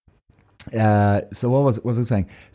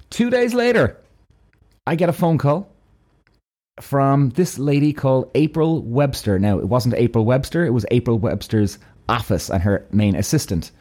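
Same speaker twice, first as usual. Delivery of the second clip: ordinary delivery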